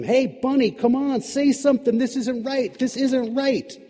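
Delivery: high, loud frantic pleading. Acted. Eve begging the frozen bunny to say something, high and frantic. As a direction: shake him and beg, voice up high.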